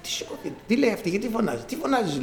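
high, soft confusion on waking; hushed, high-pitched bewilderment; bewildered patient. Retold or imitated. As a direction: imitate a groggy, puzzled patient. Groggy patient woken, retold.

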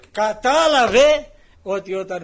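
loud, slow emphatic preaching. Real, not acted. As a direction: drive the point home slowly. Preacher stresses the point.

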